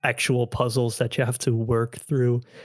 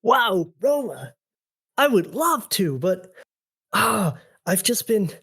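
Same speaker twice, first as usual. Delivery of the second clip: hammed-up, high anime acting; cheesy anime skit voice; 6 semitones higher than usual. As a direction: act it badly on purpose, like an anime dub. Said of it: "Anime voice".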